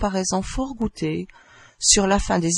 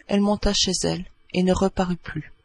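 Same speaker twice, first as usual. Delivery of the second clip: ordinary delivery, nothing marked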